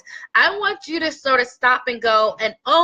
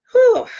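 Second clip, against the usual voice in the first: shaky breath out; 11 semitones higher, 8 dB louder than usual. Real, not acted. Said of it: "I did not come on here to cry".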